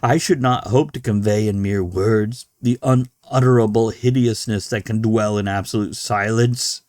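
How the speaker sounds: measured, dramatic reading of a horror tale; story narration. A narrator's reading. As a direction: read it like a confession from a doomed man. Reads Dagon aloud to listeners.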